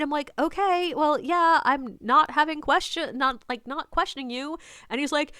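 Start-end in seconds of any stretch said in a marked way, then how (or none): 0.4-4.6 high, hesitant, placating reply; strained politeness. Retold or imitated. Tour guide placating a pushy stranger, politely. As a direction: stumble politely through a placating answer. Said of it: "trying my best to be polite".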